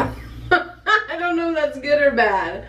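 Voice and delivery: slow, laughing through the words. Real, not acted. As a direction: giggle while unsure. Tasting strange food, laughing.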